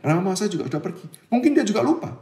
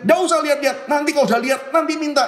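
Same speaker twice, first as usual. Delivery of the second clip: scolding parent; 9 semitones higher, faster than usual. Retold or imitated. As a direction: snap at the child to stop looking. Parent scolding child in shop. (omelan ibu yang keras dan tinggi)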